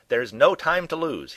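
loud, slow, high proclamation; announcing. A narrator's reading. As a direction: declare it grandly and urgently. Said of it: "he announced when he was finally upright".

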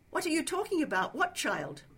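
loud, fast, high-pitched questions; bewildered demand. A narrator's reading. Trapped man to his accuser, bewildered demand. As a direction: throw the questions back, quick and loud.

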